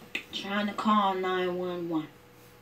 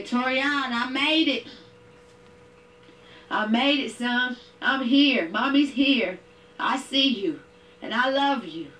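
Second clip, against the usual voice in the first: tender reassurance; 8 semitones higher than usual. Retold or imitated. Mother reaching wounded son.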